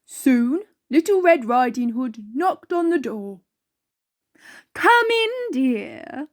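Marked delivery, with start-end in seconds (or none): 4.7-6.3 high, sugary fake-sweet call; nicest voice. Wolf disguised as grandma greets the girl, high and sugary. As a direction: put on your nicest granny voice. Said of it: "the wolf said in his nicest voice".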